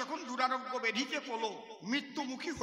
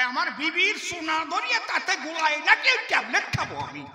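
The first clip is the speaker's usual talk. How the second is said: loud complaint; 5 semitones higher, 9 dB louder than usual. Acted. Patient complains loudly.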